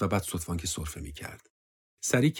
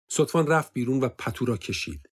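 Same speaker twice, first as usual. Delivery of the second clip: usual speaking voice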